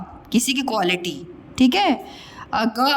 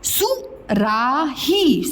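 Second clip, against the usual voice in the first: सुराही drawn out; very slow; much slower than usual. Shown as a demonstration. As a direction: stretch सुराही for the class. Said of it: "teacher models word slowly".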